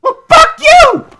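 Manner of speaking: very high, loud, slow outburst; angry yell. Pretend. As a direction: shriek it back in mock fury. Yelling back at game character.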